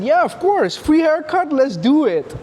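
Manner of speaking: high, excited mock friend voice; eager friend. Barber's friend jumping at a free haircut, high and eager. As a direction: squeak it out like a thrilled buddy.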